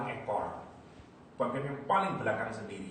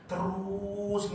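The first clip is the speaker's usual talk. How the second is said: "terus" stretched long and held higher; "terus" in one long, raised stretch; drawn-out emphasis; 7 semitones higher than usual. Real, not acted. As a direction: draw out the vowel of "terus" to show it never ends. Stretches the word for never-ending.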